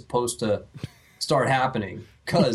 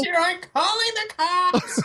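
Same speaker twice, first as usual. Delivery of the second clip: high, laughing mock panic; panicky high voice. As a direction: squeal it like a frightened wimp. Mock panicked call to neighbor.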